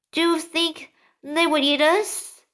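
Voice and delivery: frightened question. Acted. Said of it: "the earthworms asked".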